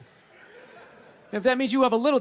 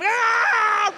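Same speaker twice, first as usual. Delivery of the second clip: high shouted attack cry; dog's sudden lunge cry; 14 semitones higher, 6 dB louder than usual. Retold or imitated. Dog lunging at a passerby, loud high cry.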